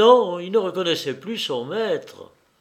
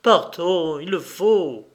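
louder, insistent; urgent plea; 3 semitones higher, 4 dB louder, slower than usual. Acted. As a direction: push him to leave now. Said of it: "saisit les mains violemment".

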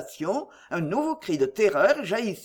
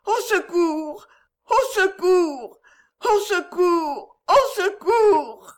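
high, slow, wailing cries building to shrieks; terrified cries for help; 8 semitones higher, 5 dB louder, slower than usual. Acted. Maid screaming to the sisters about the snake, terrified wail. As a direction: scream for help as panic mounts.

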